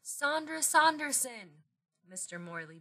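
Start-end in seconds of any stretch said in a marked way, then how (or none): 0.0-1.6 high, slow, drawn-out name; starstruck exclamation. Acted. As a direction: breathe out the star's name in awe, pitched high. Fan recognises a star.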